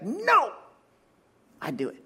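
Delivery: put-on sharp high "No" from a cross two-year-old; a mock tantrum from a toddler; angry toddler. Pastor imitating his daughter refusing help, sharp and high. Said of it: "Like, angry. She gets angry".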